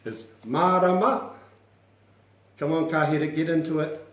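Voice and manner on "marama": "Marama" drawn up into a question; "Marama" with a clear rise at the end; asking intonation. Shown as a demonstration. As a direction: swing the end of "Marama" upward. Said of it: "It's like you're asking the question".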